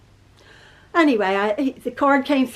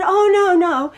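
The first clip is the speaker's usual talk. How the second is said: excited denial; 6 semitones higher, 5 dB louder, slower than usual. Retold or imitated. Son's excited denial quoted.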